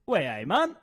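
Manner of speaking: tentative copy of Geordie; lightly imitated Newcastle accent; attempted Geordie accent. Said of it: "give the Geordie accent a go".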